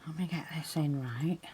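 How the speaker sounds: hushed, half-whispered concentration; soft murmur over fiddly work; talking to oneself. Real, not acted. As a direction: say it under your breath while focusing.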